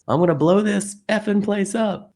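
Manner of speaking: high, loud imitated scream; retold scream. Quoting a stranger at McDonald's, imitated scream. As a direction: mimic a man yelling a threat. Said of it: "And someone screams".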